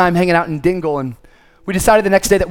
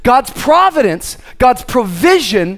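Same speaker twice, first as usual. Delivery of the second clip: raised, rhythmic preacher's cadence; emphatic preaching; 6 semitones higher, 4 dB louder than usual. Acted. Preacher assuring the congregation of God's provision, emphatic preaching. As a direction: drive each promise home with punched key words.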